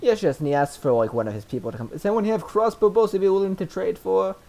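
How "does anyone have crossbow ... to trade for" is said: calling out. Acted. NPC merchant calling out offer.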